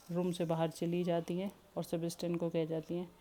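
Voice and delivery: ordinary delivery, nothing marked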